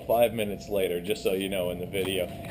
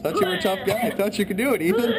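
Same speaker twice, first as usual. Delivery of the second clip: teasing; 10 semitones higher than usual. Real, not acted. Teasing friend who failed challenge.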